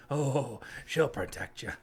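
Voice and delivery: slow, sinister mock read; menacing reading. Shown as a demonstration. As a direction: read it as a veiled threat. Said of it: "let's read it that way".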